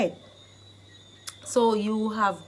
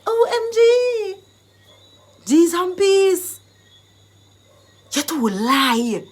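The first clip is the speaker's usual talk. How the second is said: high, loud squeal of disbelief; acted, over-the-top excitement; excited exclamation; 9 semitones higher, 7 dB louder, slower than usual. Shown as a demonstration. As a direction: burst out as if stunned by big news. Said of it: "you get all excited and you're like".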